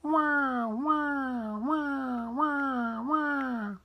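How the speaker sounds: high imitated baby cry; acted infant wailing; long high wahs of a crying baby; bawling baby wail. A narrator's reading. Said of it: "bawling her head off".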